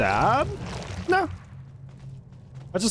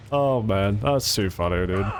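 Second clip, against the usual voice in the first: ordinary talk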